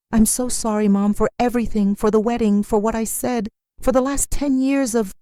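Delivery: lightly cracking, tearful apology; voice breaking. Acted. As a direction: choke up a little on each phrase. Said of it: "his voice breaking".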